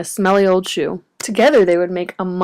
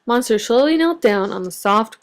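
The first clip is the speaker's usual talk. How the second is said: ordinary delivery, nothing marked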